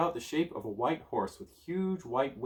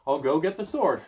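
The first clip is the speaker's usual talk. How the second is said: defensive; 5 semitones higher, 8 dB louder than usual. Acted. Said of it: "getting defensive now".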